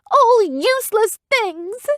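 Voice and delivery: put-on high, slow furious girl cry; raging. A narrator's reading. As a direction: rage it out in a high girl's voice. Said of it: "Evian raged".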